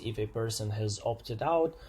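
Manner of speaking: ordinary delivery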